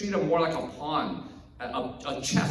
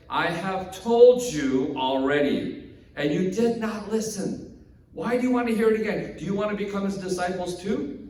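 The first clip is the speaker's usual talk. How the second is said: exasperated sarcasm; 4 semitones higher, 4 dB louder than usual. Acted. Healed man tired of repeating himself to Pharisees, exasperated and sarcastic. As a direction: spell it out, then needle them. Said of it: "getting really exasperated ... he actually gets sarcastic".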